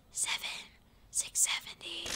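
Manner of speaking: breathy counting under the breath; quiet counting aside; whispered count. Real, not acted. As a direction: whisper the number to yourself.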